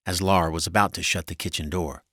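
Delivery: plain reading voice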